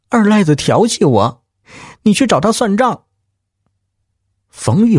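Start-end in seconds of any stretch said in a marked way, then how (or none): 0.1-3.0 higher, agitated complaint; upset wife. Acted. Wife tells husband she was harassed, upset and high-pitched. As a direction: she demands he go settle it, voice raised high. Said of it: "小兰话音儿不对".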